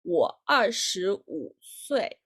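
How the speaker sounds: ordinary talk, nothing marked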